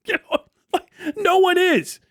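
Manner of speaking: giggling through the words; laughing. Real, not acted. Streamer laughing at the idea of uprisings. Laughing while mocking protest talk.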